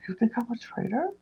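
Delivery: high, soft imitation of incredulity; mock disbelief. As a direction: retell it as a wounded, high-pitched question. Retelling Danielle's hurt disbelief.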